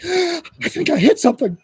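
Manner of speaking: high-pitched, rattled retold teen; mock a rattled kid after a crash; shaken teenager. Dazed teen tells his rescuer what happened, high and rattled.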